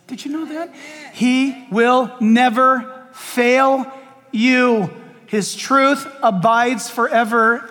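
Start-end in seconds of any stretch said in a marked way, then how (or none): 1.1-7.7 word-by-word, weighty pulpit promise; slow, measured conviction; slow emphatic declaration. Real, not acted. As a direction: space out every word deliberately. Preacher hammers home a promise.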